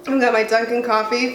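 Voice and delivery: ordinary talk, nothing marked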